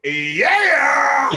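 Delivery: high, loud, drawn-out whoop; hammed-up cheer. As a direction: burst into a jubilant yell.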